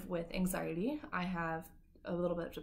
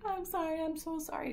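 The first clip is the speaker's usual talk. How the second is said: high, whiny fake apology; mock sob-story apology; 9 semitones higher than usual. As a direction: pile on the sorrys like a sob story. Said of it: "'cause then it becomes like this little sob story".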